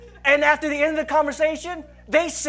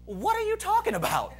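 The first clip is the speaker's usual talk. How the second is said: baffled questioning; 6 semitones higher, 8 dB quieter than usual. Retold or imitated. Puzzled at friend's gibberish.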